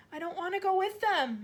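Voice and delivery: put-on high, loud defiant girl; a girl refusing outright; child shouting. A narrator's reading. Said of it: "Matilda shouted suddenly".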